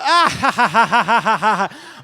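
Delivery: loud, high theatrical evil cackle; long rhythmic mock-sinister cackle. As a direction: let out a booming horror-film laugh. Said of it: "like the end of [name] Thriller".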